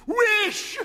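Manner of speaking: high, loud command; strong cry. A narrator's reading. Wife commanding her husband to wish, high and loud. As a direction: order it in a strong voice. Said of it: "repeated his wife".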